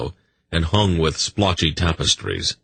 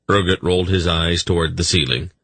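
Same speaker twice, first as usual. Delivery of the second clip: usual speaking voice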